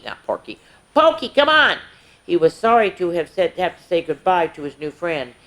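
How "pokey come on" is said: high calling voice from a distance; sister calling. A narrator's reading. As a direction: call your brother to come along. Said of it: "his sister Pickles call".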